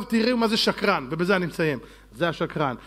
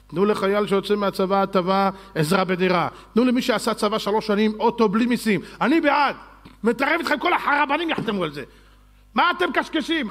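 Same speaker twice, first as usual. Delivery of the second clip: loud preaching; 3 semitones higher, 4 dB louder than usual. Real, not acted. Rabbi demands benefits for soldiers, loud and forceful. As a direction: keep hammering the point. Continues berating critics.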